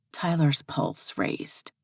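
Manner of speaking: plain reading voice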